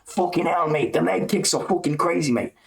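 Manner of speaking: hammed-up British commentator losing it over leg kicks; Bisping impression. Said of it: "He was talking like that as well".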